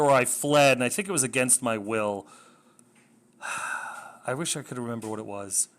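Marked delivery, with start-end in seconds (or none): 3.4-4.2 soft, voiceless sigh; long exhale of regret; resigned sigh. Real, not acted. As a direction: let out a quiet, weary breath. Regretting a forgotten reason for fleeing.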